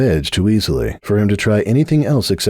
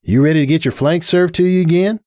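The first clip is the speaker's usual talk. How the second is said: raised, teasing Applejack voice; cocky challenge; 9 semitones higher than usual. A narrator's reading. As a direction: dare him with a grin. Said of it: "flashed him a cocky grin".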